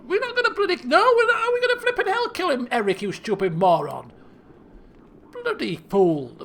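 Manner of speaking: high, exasperated rant falling into scolding; emotional outburst. Acted. King refusing to kill his daughter's bear, emotional outburst. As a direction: blurt it out, worked up. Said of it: "getting a bit emotional at the moment".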